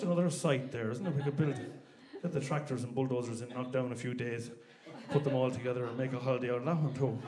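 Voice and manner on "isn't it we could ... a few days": normal talking voice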